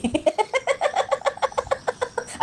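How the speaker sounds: long high-pitched cackle. Real, not acted. Vlogger mocking her rivals, long high cackle.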